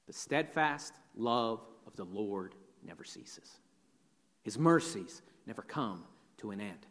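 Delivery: very soft, low scripture reading; changed, hushed tone. Acted. Jeremiah turns his mind to God's love, hushed tone. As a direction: let each phrase settle, almost under your breath. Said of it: "this depressing chapter changes tone".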